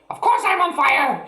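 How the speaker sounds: put-on very high, loud old woman's shriek; panicked shout. A narrator's reading. Grandma shrieking for help, very high and loud. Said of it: "she yelled".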